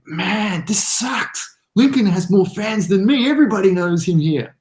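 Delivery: mock upset whine. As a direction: play a jealous friend sulking. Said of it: "getting all upset, going".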